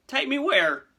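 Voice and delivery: high, loud, panicked question; trembling alarm. Acted. Hagrid demanding where he's being taken, alarmed. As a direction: burst out in fear. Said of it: "who was trembling".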